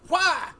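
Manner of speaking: high, loud mock war cry; sudden high shriek of attack; elf battle cry. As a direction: yell it as the elf lets the arrow fly. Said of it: "the elf is like".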